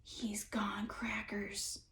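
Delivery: slow breathy whisper, barely voiced; hushed, frightened whisper. A narrator's reading. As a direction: lean in and whisper it in fear. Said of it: "Alan whispered".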